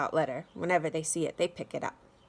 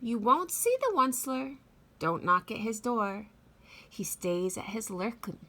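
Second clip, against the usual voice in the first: lively read-aloud with big swoops in pitch; storybook reading. A narrator's reading. Teacher reading The Lorax to children, expressive read-aloud voice. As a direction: read it like story time for little kids.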